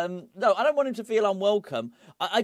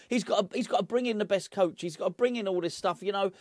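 ordinary talk, nothing marked

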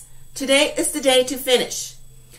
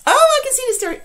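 delighted; 12 semitones higher, 4 dB louder, much faster than usual. Real, not acted. Teacher excited seeing student work.

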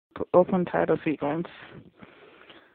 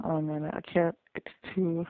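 ordinary delivery

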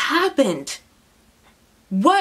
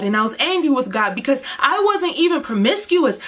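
ordinary talk, nothing marked

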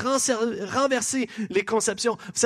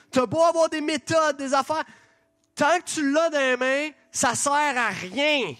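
impatient inner outcry; 5 semitones higher than usual. Retold or imitated. Preacher voicing his impatient thoughts at a paper-plane maker, high exasperation. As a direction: urge them on, pitched high and stretching the endings. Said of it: "je me disais juste".

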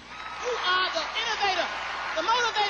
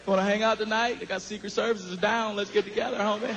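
Bill Clinton; 10 semitones lower than usual. Retold or imitated. Ex-president calling Chris to hang out, in a low booming matey voice. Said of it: "He be like".

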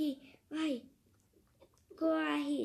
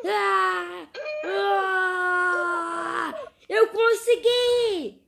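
triumphant shout; 2 semitones higher, 7 dB louder, much slower than usual. Pretend. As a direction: yell out the win. Powering up chakra, succeeds.

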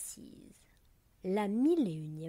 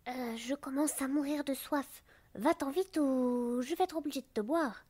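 higher, choked, strained voice; strangled voice; 6 semitones higher than usual. A narrator's reading. Thirsty anemone warning the drop away, strangled voice. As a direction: squeeze the words from a parched throat. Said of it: "dit d'une voix étranglée".

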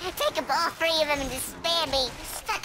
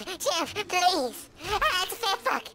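normal talking voice

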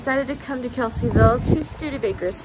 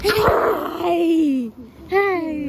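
calling out; 6 semitones higher, 5 dB louder than usual. Real, not acted. Calling out to someone.

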